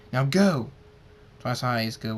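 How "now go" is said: loud shouted command; urgent order. Acted. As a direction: order them out, loudly. Orders her to flee.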